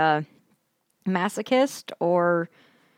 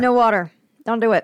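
loud warning; 5 semitones higher, 7 dB louder, faster than usual. Real, not acted. Warning after a harsh sip.

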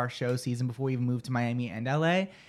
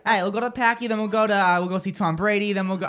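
put-on louder, higher caricature Boston accent; 5 semitones higher, 5 dB louder than usual. Host mocking hometown talk of Tom Brady, exaggerated Boston accent. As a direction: rattle off a hammed-up Boston accent. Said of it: "It's the worst accent".

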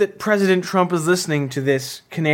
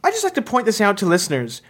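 ordinary talk

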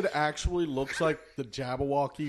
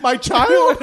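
high, loud, incredulous cry; mock indignation; 11 semitones higher, 12 dB louder than usual. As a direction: play a parent outraged at the suggestion. Acting out an offended parent.

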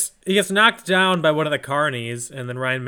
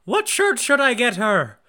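put-on high, doting old man asking advice; 13 semitones higher than usual. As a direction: play a doting stranger at a stall.

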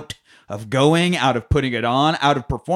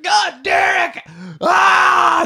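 high, loud, drawn-out yell of triumph; mock cry of joy; 5 dB louder, much slower than usual. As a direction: holler it like you won the lottery. Friend cheering from car window.

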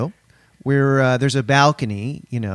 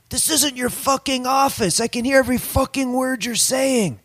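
mock angry yell; 10 semitones higher than usual. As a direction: imitate an angry neighbor yelling, volume held back. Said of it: "and he yells".

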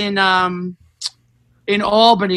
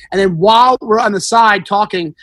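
ordinary talk, nothing marked

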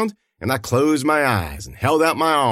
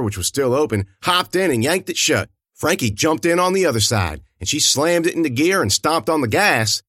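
usual speaking voice

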